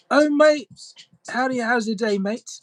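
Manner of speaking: hammed cheery Aussie hello; Australian accent. Shown as a demonstration. Streamer greets chat in a loud hammed-up Australian accent. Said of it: "Australian is hilarious".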